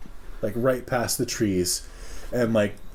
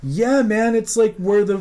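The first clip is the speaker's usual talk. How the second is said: eager explaining; 8 semitones higher, 5 dB louder than usual. Retold or imitated. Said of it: "And I'm like".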